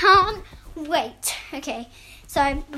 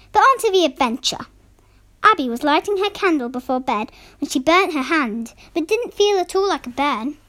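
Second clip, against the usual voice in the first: louder storyteller voice; story narration; 6 dB louder than usual. Acted. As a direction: read it like a bedtime tale. Reads story aloud.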